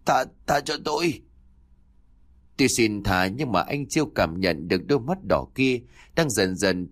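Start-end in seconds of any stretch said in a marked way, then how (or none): 0.1-1.2 high strangled begging, stammered; choked plea. A narrator's reading. Man caught by the neck begs for his life, choked. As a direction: beg for mercy through a squeezed throat. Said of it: "bị bóp nghẹt khiến cho anh thở khò khè".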